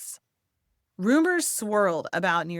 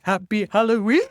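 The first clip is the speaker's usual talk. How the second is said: put-on a louder, singsong Happy Halloween to a baby; playful baby talk; 9 dB louder than usual. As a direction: coo it at a giggling baby.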